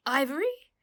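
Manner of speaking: loud, high startled question; surprised shout. A narrator's reading. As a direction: shout it in shock. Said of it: "he shouted with surprise".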